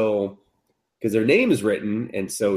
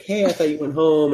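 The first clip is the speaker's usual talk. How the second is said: put-on girly voice; 7 semitones higher than usual. Girl voiced in recap.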